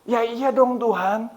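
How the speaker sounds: high, drawn-out protest; acted exasperation, stretched slowly; retold anger at God. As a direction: complain upward as if it were obvious. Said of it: "Saya marah".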